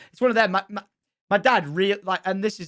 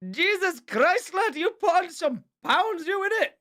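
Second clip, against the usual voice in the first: high-pitched, drawn-out teasing disbelief; mock astonishment; 13 semitones higher, slower than usual. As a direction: exclaim in mock shock, stretching the words. Mock astonishment at pet's weight.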